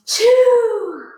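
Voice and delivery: loud high sneeze ending a build; big sneeze. Acted. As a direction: let the sneeze burst out loud.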